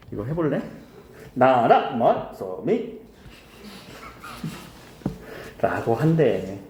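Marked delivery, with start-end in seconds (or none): 1.3-3.0 나라 말소미 read slowly, syllable by syllable in tones; slow tonal recitation of 나라 말소미; Middle Korean tones. Shown as a demonstration. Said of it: "이거에 맞춰서 우리가 읽어주면은".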